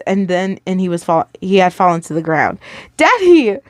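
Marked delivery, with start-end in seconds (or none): 3.0-3.6 put-on high, loud child's cry for daddy. A narrator's reading. Boy sees father fall, cries out in panic. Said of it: "Ethan cried out".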